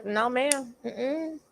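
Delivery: usual speaking voice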